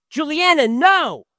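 sharp loud high cry of alarm; startled father's warning shout. Retold or imitated. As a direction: yell to stop the child. Father shouting at child near stove.

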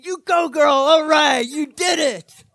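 high, loud cheering shout through a laugh. Retold or imitated. Husband cheering his wife on the phone, loud, high and laughing. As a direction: whoop her on like she won a prize. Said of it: "and I am laughing".

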